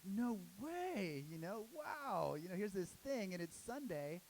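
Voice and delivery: high, slow amazed voice. Retold or imitated. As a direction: marvel at meeting a fan. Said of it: "Bruce is like".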